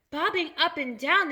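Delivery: high, slow, rising echo question; incredulous cry. A narrator's reading. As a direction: cry it back in disbelief. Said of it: "they cried".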